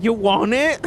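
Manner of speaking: put-on drunk offer. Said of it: "I don't even think I said it right".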